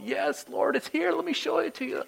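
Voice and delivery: very high, squeaky mock pleading; insistent believer, mimicked. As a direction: eagerly point it out in a put-on high voice. Believer insisting to God, acted out.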